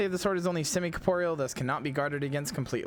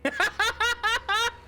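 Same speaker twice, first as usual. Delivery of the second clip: laughing; 4 dB louder than usual. Real, not acted. Laughs at the item appearing.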